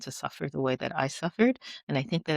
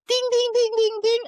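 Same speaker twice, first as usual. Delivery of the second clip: high, loud chiming "ding, ding"; mock bell ding; 6 dB louder than usual. Friends' realization at the dinner table, a high sing-song ding. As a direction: ring it out like a bell in her head. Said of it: "you saw the aha moment go".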